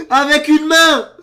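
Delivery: high, very loud, slow yell; shocked yell. Real, not acted. Reacting to one-handed solve, shocked yell. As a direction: bellow it out in disbelief. Repeats it as a shout.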